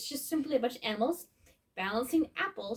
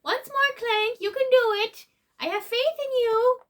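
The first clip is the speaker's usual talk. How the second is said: cheering on; 10 semitones higher, 7 dB louder than usual. Acted. Cheering on small robot.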